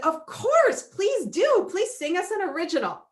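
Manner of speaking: high, loud eager pleading; eager request. Retold or imitated. Family begging the driver to sing, high eager delight. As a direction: beg him to sing, delighted.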